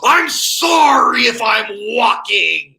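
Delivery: high, loud, drawn-out sarcastic yell; mock outraged pedestrian holler; crazed indignant shout. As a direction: stretch it out and shout it at the driver. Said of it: "giving him that crazy look".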